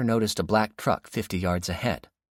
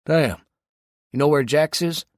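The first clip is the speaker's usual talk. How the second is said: Dave on the radio; 9 semitones higher, 7 dB louder than usual. A narrator's reading. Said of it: "Dave said".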